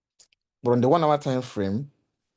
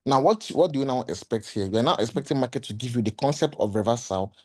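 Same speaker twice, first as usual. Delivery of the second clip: ordinary talk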